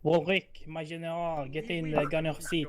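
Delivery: put-on French accent. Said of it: "If you speak in a French accent again".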